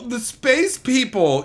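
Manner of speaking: ordinary talk, nothing marked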